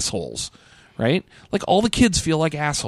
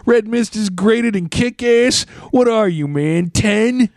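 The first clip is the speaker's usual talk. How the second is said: higher, hammed-up New York scoffing; New Yorker accent; 3 semitones higher than usual. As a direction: argue in broad, brash New Yorkese. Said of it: "all New Yorkers talk like that".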